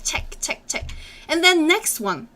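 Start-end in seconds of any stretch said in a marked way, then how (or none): 0.0-0.9 whispered demo. Shown as a demonstration. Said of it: "you close your throat with your tongue".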